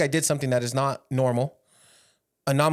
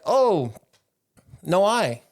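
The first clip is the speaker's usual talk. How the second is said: broken English; 5 semitones higher, 3 dB louder, much slower than usual. Retold or imitated. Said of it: "In his broken English".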